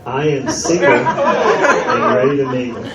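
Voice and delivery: slow, playful fake Jamaican patois; mock Jamaican patois. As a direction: stretch it out in a joking Jamaican accent. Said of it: "I will translate it to Jamaican patois".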